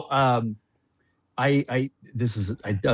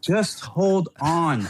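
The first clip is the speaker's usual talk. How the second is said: very slow, stretched words, pitched up; drawn-out stalling; 5 semitones higher, much slower than usual. Retold or imitated. Husband putting off his impatient wife, drawn-out stalling. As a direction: stretch each word to put someone off.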